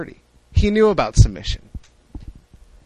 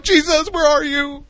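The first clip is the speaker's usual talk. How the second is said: worried mother; 8 semitones higher than usual. Retold or imitated. Mary searching for Jesus.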